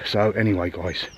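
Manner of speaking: normal talking voice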